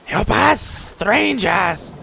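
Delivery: high, drawn-out kobold outcry; fun creature voice. Acted. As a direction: cry out accusations in pidgin creature speech. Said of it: "Fun voices".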